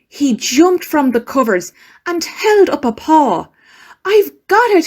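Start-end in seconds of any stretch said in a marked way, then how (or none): 4.0-4.9 high, eager squeak of discovery; bright mouse cry, much higher; excited mouse. Acted. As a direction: pop up with the idea. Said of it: "he said".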